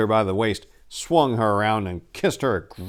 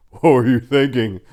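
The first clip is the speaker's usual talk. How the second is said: put-on drunken laugh; 3 semitones higher, 9 dB louder than usual. A narrator's reading. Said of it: "laughed Turga drunkenly".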